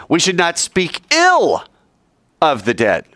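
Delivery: "ill" stressed with a high start. Shown as a demonstration. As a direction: punch "ill" and fall on "of the dead". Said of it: "as I accent and stress each word".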